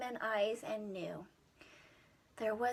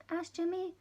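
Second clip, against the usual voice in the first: plain reading voice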